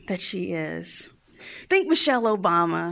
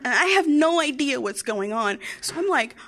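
ordinary delivery

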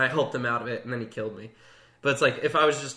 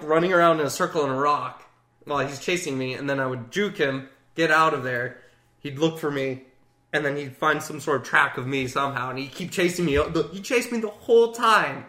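usual speaking voice

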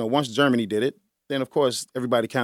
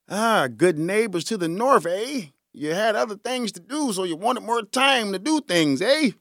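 higher-pitched, lilting mock Canadian; bad Canadian accent; 6 semitones higher than usual. Host teases the good neighbors to the north, bad Canadian accent. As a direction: put on a badly faked Canadian accent. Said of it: "My Canadian accent sucks".

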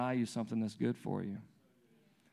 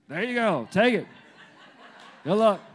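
loud, higher-pitched tossed-off offer; mock careless father; 8 semitones higher, 7 dB louder than usual. Dad handing toddler a cold waffle, mock careless shout. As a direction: call it out as a hammed-up bad dad.

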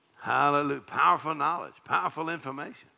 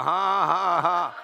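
loud, raised, deliberate ha-ha; practice laugh; 4 semitones higher, 5 dB louder, much slower than usual. Shown as a demonstration. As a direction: lead the crowd in a forced laugh. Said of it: "Come on, practice a while".

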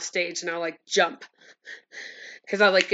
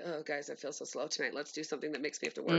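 tired mumble; 5 semitones lower, 16 dB quieter, faster than usual. Retold or imitated. Mock complaint about feeling slow.

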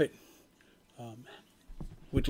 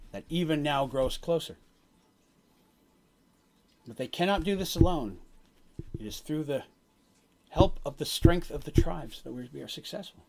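solemn prophet. Acted. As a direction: deliver a grave omen, phrase by phrase. Game master voices the prophet's prophecy.

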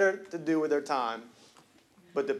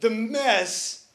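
full-force preaching; 5 semitones higher, 7 dB louder than usual. Acted. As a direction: preach it, building to the crowd's amens.

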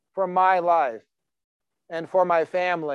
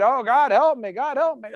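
higher-pitched, pleading mock prayer; mock desperate prayer; 8 semitones higher than usual. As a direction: play a sick believer begging God, hammed-up. Mock pleading prayer while sick.

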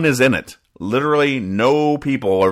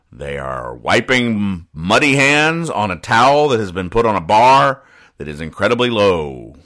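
usual speaking voice

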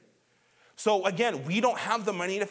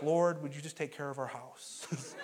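put-on kids' prayer; 4 semitones lower, 6 dB quieter than usual. Kids' memorized prayer quoted.

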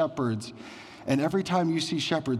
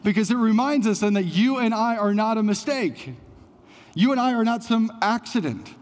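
preaching at full force; 6 semitones higher than usual. Acted. Pastor tells the congregation they are no mistake, preaching. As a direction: drive it home to the church.

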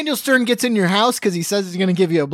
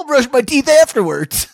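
loud, higher comic exclamation; mock eager shout; 6 semitones higher, 8 dB louder than usual. Man just woken by the app, eager mock shout. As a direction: blurt it out high and loud.

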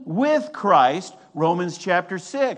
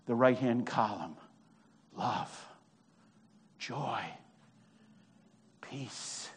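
low, soft reverence; quiet awe, fading almost to nothing; hushed preaching; 8 semitones lower, 8 dB quieter than usual. Real, not acted. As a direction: let it sink softer, with long pauses. Preacher hushes after the climax.

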